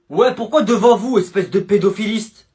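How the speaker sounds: usual speaking voice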